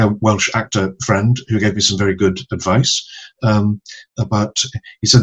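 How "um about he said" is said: ordinary talk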